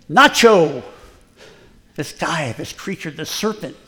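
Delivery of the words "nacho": imitating Pastor Ernest's Swiss accent, loud; "Nacho" said loudly in a Swiss accent. Pastor imitating his Swiss mentor's accent, loud. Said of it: "he's got this accent from Switzerland".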